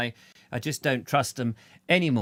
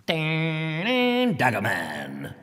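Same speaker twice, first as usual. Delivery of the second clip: dramatic title; 2 semitones higher, much slower than usual. Pretend. Naming a viewer's alter ego.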